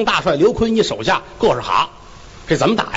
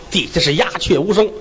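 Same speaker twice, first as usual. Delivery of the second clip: ordinary delivery, nothing marked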